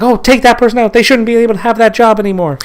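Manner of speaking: high, mocking voice of an outraged online crowd; mock outraged mob. Mocking people who want someone fired, in a high outraged voice. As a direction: lampoon the mob crying for someone's job, pitched high.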